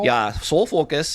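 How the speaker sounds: slow Minnesota vowels on Soul Focus; drawn-out Minnesota "Soul Focus"; Minnesota accent. Shown as a demonstration. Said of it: "real strong Minnesota accent".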